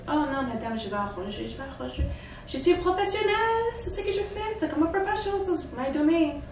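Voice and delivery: high, breezy mock planner, overconfident. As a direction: ham up the sure-of-herself planner. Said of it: "she showed me the picture".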